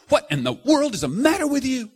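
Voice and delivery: loud shocked father's yell; furious dad shouting; yelling at son. Retold or imitated. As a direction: yell at the fighting boy. Father yelling at fighting sons.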